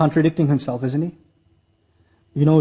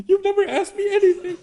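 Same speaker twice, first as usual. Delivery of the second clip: much higher, laughing mock cry of a general; half-laughing fake sob; mock tearful courtier; 13 semitones higher than usual. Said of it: "people in the audience that must have cried".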